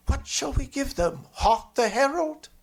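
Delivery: a hint of timid carol singers; nervous boys. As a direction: fret about the song like scared boys at the door. Boys whisper-plotting at dark house.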